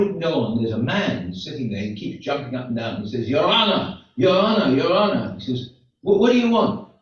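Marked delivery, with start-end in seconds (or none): 3.3-5.4 calling out. Acted. Man jumping up in court. 6.0-6.9 irritated judge. Acted. Judge snaps at interrupter.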